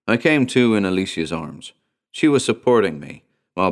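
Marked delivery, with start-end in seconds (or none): none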